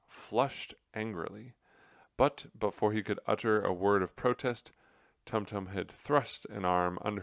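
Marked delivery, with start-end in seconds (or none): none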